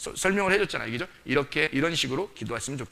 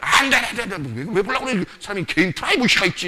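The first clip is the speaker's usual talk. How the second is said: higher, louder flustered "no, no, no"; panicked refusal; 5 semitones higher, 6 dB louder than usual. Retold or imitated. Partner hiding a phone from their lover, loud panicked refusal. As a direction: shoo the partner away from the phone in alarm.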